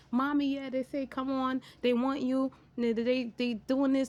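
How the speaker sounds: put-on high, whiny kid begging Mommy; pleading daughter. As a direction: imitate a child nagging her mom. Mom voicing her daughter's pleading.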